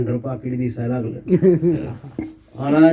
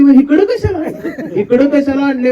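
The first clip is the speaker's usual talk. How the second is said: much higher, louder mock scolding; scolding wife; 11 semitones higher, 4 dB louder than usual. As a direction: snap at the husband for bringing a guest home. Wife scolds husband at door. (नवऱ्याला रागावणारी बायको, उंच मोठ्या आवाजात नक्कल)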